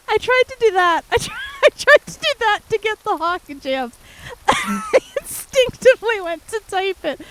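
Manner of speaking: breaking up with laughter while telling it; laughing hard. Real, not acted. Streamer tells chat her typing slip, laughing hard.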